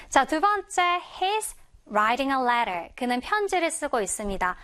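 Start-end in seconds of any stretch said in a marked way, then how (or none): 1.2-2.9 "writing" and "letter" with flapped, r-like t's; slow, smooth American "writing a letter"; soft American pronunciation. Shown as a demonstration. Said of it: "부드럽게 미국식으로 발음을 한다면".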